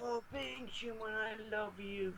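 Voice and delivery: high, soft, drawn-out adoring coo; mock swooning. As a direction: swoon over it in a put-on high voice. Mock swooning love declaration to show.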